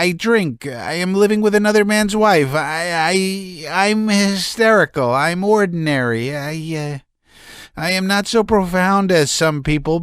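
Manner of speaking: high, strained, nervous voice; a man near hysterics pouring it out; agitated Laevsky. A narrator's reading.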